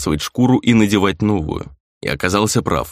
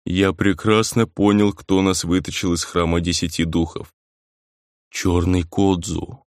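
slow, weighty pronouncement; impressive, weighty; 3 semitones lower than usual. A narrator's reading. Grizzly telling Rei who rescued them, weighty. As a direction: let each word sink in slowly. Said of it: "и произнёс внушительно".

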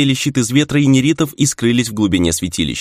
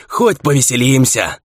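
higher, drawn-out battle cry; slow, raised cry to comrades; exuberant shout; 4 semitones higher than usual. A narrator's reading. Fighter to comrades mid-battle, shouting with relish. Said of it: "прокричал земляк Торы".

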